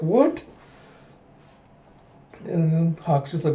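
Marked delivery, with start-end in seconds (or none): 0.0-0.4 high-pitched mock surprise; baffled. Princess startled by a claimed childhood vow, baffled. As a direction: blurt a startled, high question.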